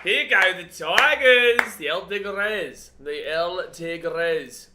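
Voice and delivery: loud, drawn-out hype call; excited team cheer. Real, not acted. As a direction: stretch out the team's name in a big cheer. Cheering on the Tigers live.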